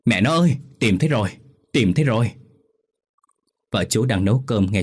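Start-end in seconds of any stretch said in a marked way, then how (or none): 0.1-2.3 raised, higher call to his wife; excited call of discovery; calling out excitedly. Acted. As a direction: shout the good news across the yard. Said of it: "Chú kêu lên".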